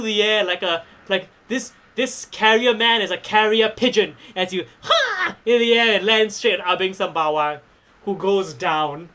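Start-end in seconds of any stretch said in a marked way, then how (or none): 4.8-5.4 high-pitched battle cry "ha"; sudden high acted exertion shout; leaping "ha". Game master voicing a wrestler soaring through the air, leaping cry.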